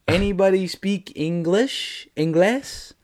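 slow, spaced-out question, lifting at the end; careful asking. Retold or imitated. Asking cleaners who speak no English, slow and tentative. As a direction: spell it out for non-speakers.

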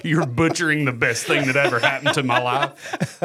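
laughing through an imagined complaint; amused mock outrage. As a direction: play the offended singer while cracking up. Voices [name]'s imagined reaction, laughing.

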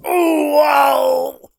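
high, hammed-up dying yell from an old game; imitated death cry. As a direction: throw out a cartoonish death wail, high up. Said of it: "there's one of a guy going".

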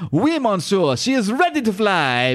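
high, hammed-up French accent. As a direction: play the French sidekick, proud and eager. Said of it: "this is motherfucking Frenchie".

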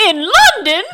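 high, loud laughing British "In London?"; British accent. Acted. Joey from Friends imitated in a British accent. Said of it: "I did that with a British accent".

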